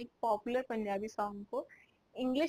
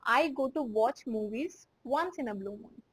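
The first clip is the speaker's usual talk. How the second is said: slow, clearly spaced example sentence; slow model sentence; much slower than usual. Shown as a demonstration. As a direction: read it slowly for learners. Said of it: "मैं बोल सकती हूँ".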